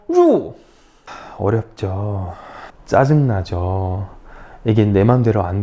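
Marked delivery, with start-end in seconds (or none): 0.1-0.5 루 falling sharply from high; fourth tone. Shown as a demonstration. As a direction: drop 루 fast from the top. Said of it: "사성".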